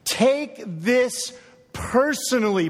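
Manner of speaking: slow, with THIS stressed; slow, emphatic appeal as Paul; urgent plea. Retold or imitated. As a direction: urge the listener to take it to heart. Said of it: "Paul says".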